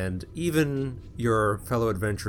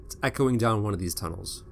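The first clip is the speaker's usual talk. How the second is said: ordinary delivery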